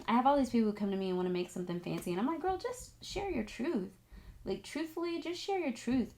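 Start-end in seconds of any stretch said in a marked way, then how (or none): 2.4-6.2 a light, tender nudge; gentle advice. Retold or imitated. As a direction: ease a friend off trying too hard. Advising people who come to her.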